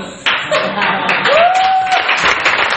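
a long high laugh breaking out; amused high laugh; high burst of laughter. Real, not acted. Laughs at not understanding plan.

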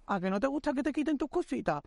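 high, singsong taunt; high-pitched mock-sweet needling. Acts girlfriend taunting the thief.

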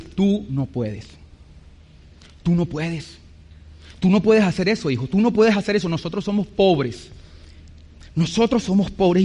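hushed, low maternal resignation; discouraging mother. Retold or imitated. Mother telling her son they are poor, quietly discouraging. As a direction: quietly tell a child it is impossible. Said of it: "Y mamá me dice".

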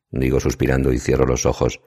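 usual speaking voice

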